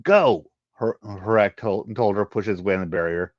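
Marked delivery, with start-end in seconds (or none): 0.0-0.5 loud, higher barked order; urgent command. Acted. Harak orders Genesis to leave, loud and urgent. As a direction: snap it out.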